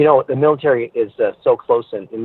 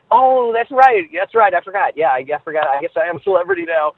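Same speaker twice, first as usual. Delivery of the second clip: much higher, sheepish oh-right; retold dawning realization, higher at first then settling; sudden realization; 6 semitones higher than usual. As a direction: catch yourself in mock embarrassment. Realizing he is the celebrity.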